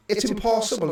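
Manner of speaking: usual speaking voice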